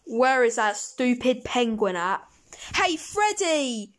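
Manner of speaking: usual speaking voice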